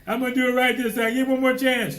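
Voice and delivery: high, loud begging for another chance; mock pleading. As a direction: plead like someone full of regret. Voicing pleading person.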